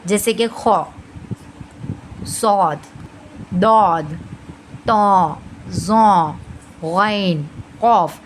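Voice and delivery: full, rounded heavy Arabic letters; each of "खौ, सौद, दौद" sounded heavy, one by one. Shown as a demonstration. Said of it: "उसे हम heavy करके पढ़ेंगे".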